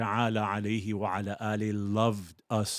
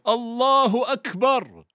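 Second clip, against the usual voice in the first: much higher, louder triumphant shouting; joyful loud cry; 12 semitones higher, 7 dB louder than usual. Acted. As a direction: cheer it out like a victorious crowd. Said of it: "very loudly said".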